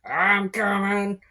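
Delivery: put-on suspicious old goblin at the door; lightly played wary old goblin; lightly voiced old goblin.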